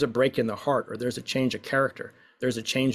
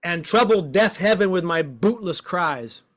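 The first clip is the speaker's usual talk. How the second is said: slow, higher, louder cry to the gods; dramatic lament; 5 semitones higher, 4 dB louder than usual. Shown as a demonstration. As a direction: rail at heaven, slowly. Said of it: "let's shake our hands at the gods".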